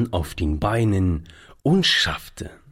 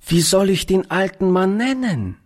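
put-on higher-pitched small child's question; lightly voiced little girl; 9 semitones higher than usual. A narrator's reading. Little girl asks Beppo about the old man, high child voice.

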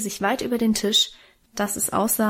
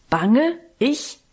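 rumbling indignation; 2 semitones higher than usual. A narrator's reading. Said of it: "grollte Karl".

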